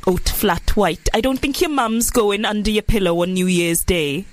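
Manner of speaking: put-on Welsh accent. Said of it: "That's good".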